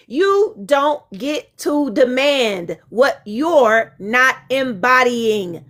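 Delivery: each word slow and deliberate, x0.5; slow emphatic reread. Shown as a demonstration. Rereading her line slowly for the people in the back. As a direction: spell it out slowly so the back row hears. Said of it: "I'ma read that again for the people in the back".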